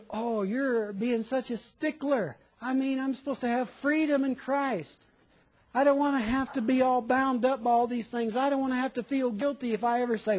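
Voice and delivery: put-on grumbling protest; complaining objector. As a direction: argue back like an aggrieved listener. Voices an objecting church member.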